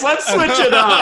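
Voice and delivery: high, laughing repeat; laughing sing-song. Real, not acted.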